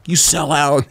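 high, loud heckle; jeering mock callout; mock accusation. Retold accusation by social media followers.

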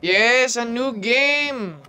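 high, slow delighted cheer; excited. Real, not acted. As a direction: savor it, thrilled. Excited about game.

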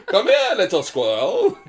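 put-on slow, high British old man. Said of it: "Why is your old man voice British?".

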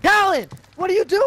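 very high, loud shout; panicked yell. Real, not acted. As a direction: yell in alarm at a teammate. Teammate in danger.

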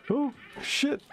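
high startled yelp. Real, not acted. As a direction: yelp in fright. Sudden scare by dolls.

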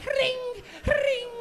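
high, loud ringing "ring"; bike bell imitation. Mimicking cops rolling by on bikes, high bell imitation. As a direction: sing out like a bicycle bell.

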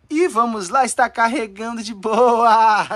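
high start, long stretched final word; excited announcement. Real, not acted. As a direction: draw the ending out in delight. Celebrates game loading, drawn out. (anúncio empolgado, esticando o final)

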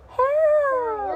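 very high, loud drawn-out squeal; squeaked cry for help. A narrator's reading. Mouse stuck on the rails as a trolley comes, squeaked cry for help. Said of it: "I squeaked".

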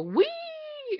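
long, high, drawn-out "whee"; mock gleeful whee. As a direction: squeal it like coasting downhill. Imitates rolling downhill in wheelchair.

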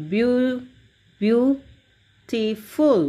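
"beautiful" very slow, beau... beau-ti-ful, with gaps; pronounce-along syllables. Shown as a demonstration. Teacher leading students to pronounce along, "beautiful" slowly in pieces. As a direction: break "beautiful" into separate held syllables, first one repeated. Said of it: "Let's pronounce with me".

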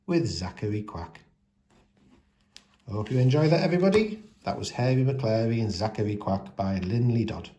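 usual speaking voice

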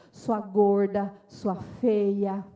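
low, drawn-out, forceful put-down; cutting insult. Acted. Parent putting down a child, harsh insult. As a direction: stretch each insult out slowly and hard.

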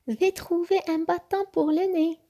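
high-pitched, cheerful announcement; high, excited storybook character; eager exclamation. A narrator's reading. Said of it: "s'exclama Peach sans l'écouter".

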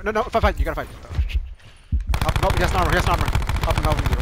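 loud, high frantic orders; urgent shouting. Real, not acted. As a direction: bark it at your team in panic. Frantic orders during teammate fight.

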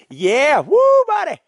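high, loud happy whoop; excited whoop. Real, not acted. Settling into his hammock, a loud, high, excited whoop. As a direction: let out a big cheer of joy.

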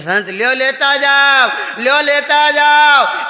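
high, loud, drawn-out call of લ્યો લેતા જાઓ; calling after someone. Retold or imitated. Wife running after the fleeing saint, calling out loud and slow. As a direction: chase him down the lane shouting.

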